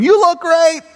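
high marathon spectator shout; yelled cheering. Retold or imitated. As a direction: cheer runners on from the roadside. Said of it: "the whole time yelling".

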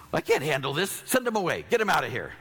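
high, pleading mock panic; panicked disciples. Disciples pleading with Jesus over the crowd, mock panic. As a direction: beg Jesus to make it stop.

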